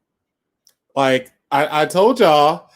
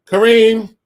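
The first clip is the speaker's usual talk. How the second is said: a loud call of the name; 5 semitones higher, 6 dB louder than usual. Real, not acted. Calling out to Kareem before a playful demand, loud. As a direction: get his attention across the room.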